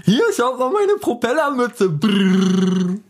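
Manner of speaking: very high, slow cartoon kid; Infantino voice. Showing his propeller cap to listeners, in a squeaky kid voice. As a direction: show off like a silly child. Character shows off his hat.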